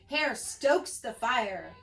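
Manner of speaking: ordinary delivery, nothing marked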